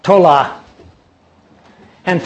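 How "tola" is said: tola said slowly as TOH-lah. Shown as a demonstration. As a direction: say the Hebrew word tola carefully, TOH-lah. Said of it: "It is".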